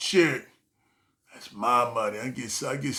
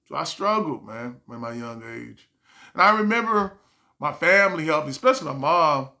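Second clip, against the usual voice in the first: ordinary talk, nothing marked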